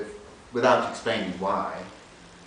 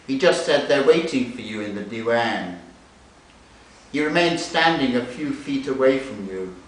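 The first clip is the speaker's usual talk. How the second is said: raised, louder story narration; literary reading; 5 semitones higher, 7 dB louder than usual. Acted. Reading a novel passage to an audience, lifted narration. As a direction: read the passage aloud with feeling. Reads novel excerpt aloud.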